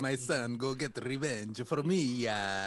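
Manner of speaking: hammed-up Italian-accented Zeus; mock Italian accent. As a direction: play a father-god with a thick Italian accent. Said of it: "but he sounds Italian".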